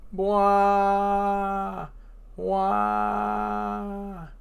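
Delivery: a wailing whale cry; a giant whale bawling. Acted. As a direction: wail like a heartbroken whale. Whale Laboon crying.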